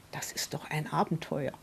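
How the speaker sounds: near-whisper, dropping very soft; hushed confiding. Real, not acted. Grandmother confides her realisation about the adventure, hushed. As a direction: let the thought sink to a whisper.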